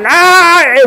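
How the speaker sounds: sudden high shout of "아"; mock pig-squeal yell. Shown as a demonstration. Monk mocking over-loud chanting with a yell. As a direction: ham up a squealing cry. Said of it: "막 이렇게 하라는 것이 아니라".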